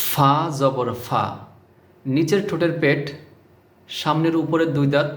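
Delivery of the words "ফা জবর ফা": slow lesson demo of ফা জবর ফা; slow careful pronunciation. Shown as a demonstration. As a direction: say ফা slowly with the teeth on the lip. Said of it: "এভাবে".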